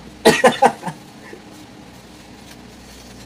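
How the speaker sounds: loud spluttering laugh after choking. Real, not acted. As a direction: laugh and cough through a choked sip. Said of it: "Nasamid ako".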